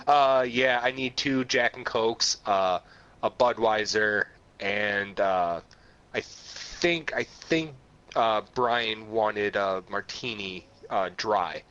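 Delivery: put-on low American bar order, no Jamaican accent. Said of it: "without a hint of Jamaican accent".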